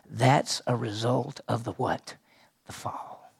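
slow, then breathe the answer out unvoiced; hushed answer. Real, not acted. Pastor prompting congregation, whispered answer. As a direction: drop to a whisper on the fall.

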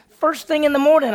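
can't wait for morning, shouting; gleeful high shout; eager shout. Acted. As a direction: shout it, high and loud with glee.

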